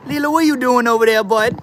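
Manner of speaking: very high, sing-song call to the pet; pet talk. Real, not acted. Streamer calls over to his pet Lila, high cooing voice. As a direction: coo at the pet from across the room.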